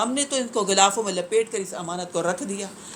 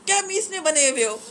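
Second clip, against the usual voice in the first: higher mock scold at a mumbling child; 8 semitones higher than usual. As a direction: scold the child, half-exasperated. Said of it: "ہم کہتے ہیں".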